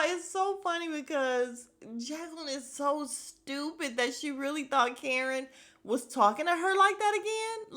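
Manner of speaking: usual speaking voice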